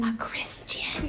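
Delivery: slow, playful echoed whisper. Real, not acted. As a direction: whisper it back, teasing.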